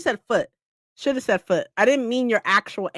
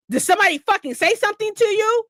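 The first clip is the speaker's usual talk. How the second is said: high, fast, rushed mock threat; ready to fight, fast and high-pitched; mimicking a fired-up friend; 9 semitones higher, much faster than usual. Friend from the comments ready to fight for her, fast and high.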